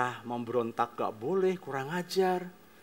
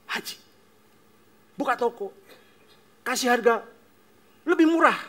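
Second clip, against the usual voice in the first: louder, higher, worked-up complaint; upset father complaining; 8 semitones higher, 7 dB louder than usual. Acted. Father pouring out shop troubles to son, rising and loud. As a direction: vent the unfairness, voice climbing.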